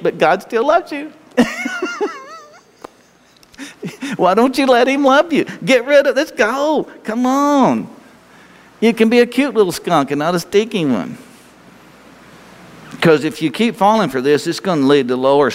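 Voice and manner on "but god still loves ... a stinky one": high, teasing coaxing voice. Acted.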